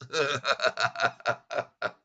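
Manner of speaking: short burst of laughter. Real, not acted. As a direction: chuckle at the headline. Laughing at headline reversal.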